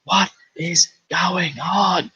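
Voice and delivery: slow, high, baffled "what is going on"; mock the confused crowd, drawn out; hammed-up confusion; bewildered. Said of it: "we're all like".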